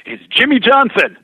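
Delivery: acted shout. Driver naming who he wants to visit first, acted shout. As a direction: belt out the name as in a retold scene. Driver announcing his rival's name.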